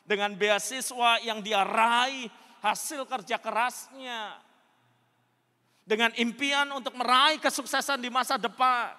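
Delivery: loud, high-pitched preacher delivery; forceful preaching. Real, not acted. Preacher tells congregation how he prayed for her, forceful preaching.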